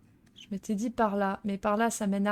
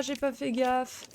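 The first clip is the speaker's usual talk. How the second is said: ordinary delivery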